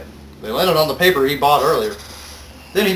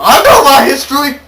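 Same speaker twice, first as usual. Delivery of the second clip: high, loud defensive outburst; indignant; 11 semitones higher, 10 dB louder than usual. Real, not acted. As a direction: insist loudly. Defends himself loudly.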